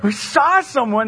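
whiny voice; a touch of a whining child; whiny tattletale. Retold or imitated. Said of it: "whiny little b".

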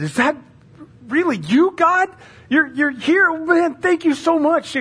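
high, loud, overjoyed disbelief; amazed relief. Acted. Hiker realizing God has found him, amazed and relieved. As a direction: gush with astonished thanks, acted.

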